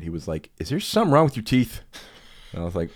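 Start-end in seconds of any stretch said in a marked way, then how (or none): 0.6-1.8 imitating an irritated father, pitched well up; louder, exasperated parental question; annoyed dad. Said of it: "he was just annoyed".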